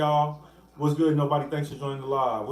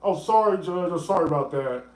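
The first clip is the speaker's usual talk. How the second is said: higher, put-on apologetic prosecutor voice; mock a flustered DA begging the court's pardon; unprepared DA; 4 semitones higher than usual. Imitating the unprepared DA asking the judge for a minute, mockingly apologetic. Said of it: "D A poorly prepared".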